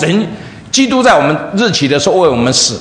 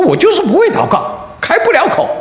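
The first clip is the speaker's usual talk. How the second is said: laughing embarrassed; 13 semitones higher than usual. Retold or imitated. Woman admits she cannot pray.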